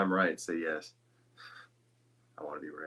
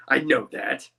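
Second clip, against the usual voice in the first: playfully talking down to someone; hammed-up superiority; mock condescension; 5 semitones higher, 8 dB louder, slower than usual. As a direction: put on haughty offence, in jest. Mock condescension after joke mispronunciation.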